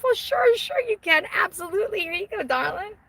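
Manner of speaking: high, hammed-up star indulging fans; rock star. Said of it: "feel like a fucking rock star".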